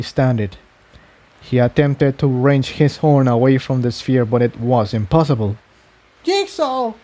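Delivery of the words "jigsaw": alarmed high call across the room; shout. A narrator's reading. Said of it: "Tiptoe shouted".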